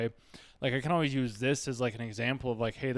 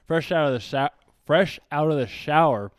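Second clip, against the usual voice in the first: wearing a retainer; 3 semitones higher, 6 dB louder than usual. Real, not acted. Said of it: "got my retainer in".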